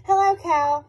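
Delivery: high, loud bright hello from Duck; a chirpy duck calling out hello; cheery duck voice. Acted. Duck greets cow in story.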